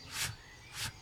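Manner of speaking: "フ" as a soft voiceless f; two quiet f breaths for "フ"; English F sound. Shown as a demonstration. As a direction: push air for "フ" between teeth and lip. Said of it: "Fの音は".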